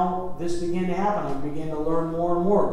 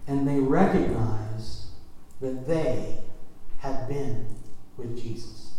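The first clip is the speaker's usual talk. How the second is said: slow, lower reading of the verse; solemn scripture reading; 5 semitones lower, slower than usual. Acted. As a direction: let each phrase land slowly.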